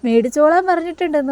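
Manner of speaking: high, rising call across to someone out of sight. Retold or imitated. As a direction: sing it out so she hears from hiding. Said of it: "വിളിച്ച് പറയുകയാണ്".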